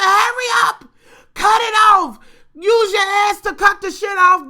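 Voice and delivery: high, loud shouted orders; hollering. Retold or imitated. Auntie hollering through the door, high shouted orders. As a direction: yell it like a frantic boss. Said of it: "And then she said".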